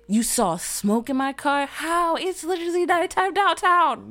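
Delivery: high, loud exasperated disbelief; incredulous outrage. Retold or imitated. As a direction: rant at the absurd stop.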